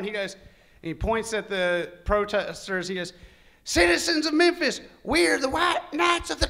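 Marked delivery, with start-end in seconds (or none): 3.6-6.5 high, loud proclaiming voice; pompous announcer. Retold or imitated. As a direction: announce grandly to the crowd. Leader addresses protesters.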